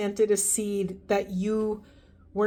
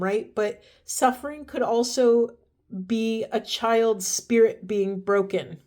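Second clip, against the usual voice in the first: normal talking voice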